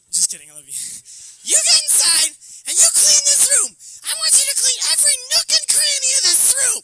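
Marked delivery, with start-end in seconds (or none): 1.5-6.8 very high, loud screeching mom; high-pitched hammed-up mom yell; mom impression. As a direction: yell it as an exaggerated scolding mother. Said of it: "That's how she sounds".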